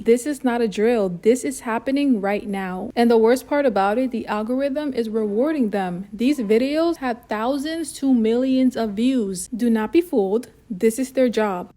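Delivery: loud, driving alarm-style intro; forceful, unbroken public warning; urgent warning announcement. Acted. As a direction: announce it like an emergency bulletin.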